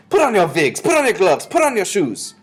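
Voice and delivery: put-on high, loud accented commands; witch's shouted orders. A narrator's reading. Grand High Witch orders witches into disguise, shouting. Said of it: "shouted the Grand High Witch".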